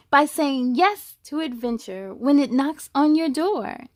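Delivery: rhyming storybook read with a steady lilt; picture-book narration; reading to children. A narrator's reading. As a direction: read it to a class of kids. Reads a picture book aloud.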